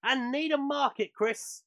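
radio sports roundup read; news read. Acted. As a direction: deliver the results like a bulletin. Reads the weekly football roundup.

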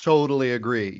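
ordinary delivery

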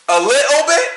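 'A little bit?' rising in disbelief; incredulous echo. Real, not acted. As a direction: repeat it back, baffled. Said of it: "Ain't it supposed to be a T right there?".

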